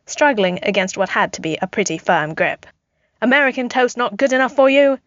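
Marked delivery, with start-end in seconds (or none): 3.2-5.0 yelling back. A narrator's reading. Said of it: "Steve yelled back".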